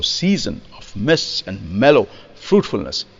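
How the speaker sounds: measured verse with "season" accented at the front; accent on first syllable. Shown as a demonstration. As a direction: stress on SEA in "season", then MISTS and MEL. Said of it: "the accent is on the first syllable".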